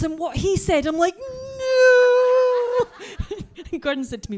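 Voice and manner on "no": very high, loud shocked no dissolving into laughter; mock horror turning to laughter; shocked no, then laughing. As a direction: shout it then crack up.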